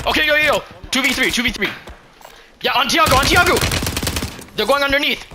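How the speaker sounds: high, loud, urgent calls; excited callout. Real, not acted. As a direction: yell callouts to teammates. Excited callouts in clutch fight.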